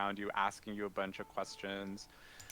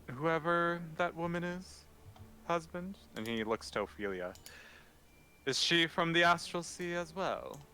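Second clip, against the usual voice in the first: questioning knight; 8 semitones higher than usual. Acted. Game master voices Sir Melfor.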